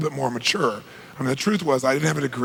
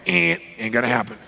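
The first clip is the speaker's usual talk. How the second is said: dismissive; 5 semitones lower than usual. Retold or imitated. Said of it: "I go".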